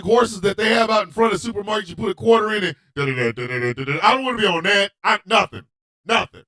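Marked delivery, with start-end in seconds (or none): 2.9-4.0 low monotone "da-da-da"; mimicked kiddie-ride noise. Describing supermarket plastic horses, droning imitation of the ride. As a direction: imitate a mechanical toy horse, pitched low.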